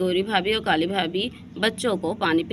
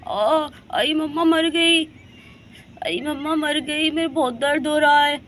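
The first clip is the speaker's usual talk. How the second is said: crying out in pain; 5 semitones higher than usual. Acted. Poisoned girl wailing to her mother in pain.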